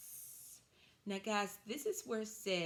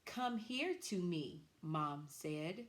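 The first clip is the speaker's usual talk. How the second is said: slow model reading; slower than usual. Shown as a demonstration. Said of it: "our sentence that we're going to use today is this".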